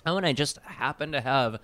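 talking through a mouthful of peanut butter; sticky mouthful while speaking; mouth full of peanut butter. Real, not acted. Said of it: "a spoonful of peanut butter".

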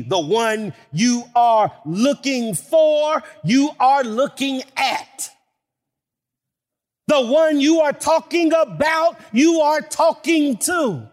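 rising, emphatic preaching, drawn out. Acted. Preacher to his congregation, building emphatic cadence. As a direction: build each line toward the congregation.

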